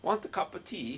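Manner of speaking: high-pitched, hammy British accent; badly faked British tea invitation; attempted British accent. Shown as a demonstration. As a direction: try out a comic British accent. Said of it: "work on the British accent".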